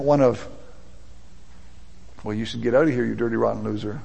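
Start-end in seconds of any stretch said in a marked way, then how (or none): 2.2-4.0 low, quiet contempt for a loser; mock dismissive rebuke. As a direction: play a scornful churchgoer, voice lowered. Mock harsh response to confession.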